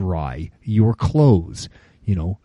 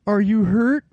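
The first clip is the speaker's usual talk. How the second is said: put-on high bright concerned kid voice; mock young hiker; 10 semitones higher than usual. Young hikers checking on him, high mock-concern. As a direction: ask it like a carefree youngster. Kids asking again.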